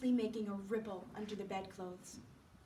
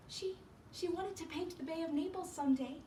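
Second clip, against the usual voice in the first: higher, hesitant answer; a touch of a worried friend, pitched up; lightly voiced Sue; 6 semitones higher than usual. Sue answering the doctor about Johnsy, higher and hesitant.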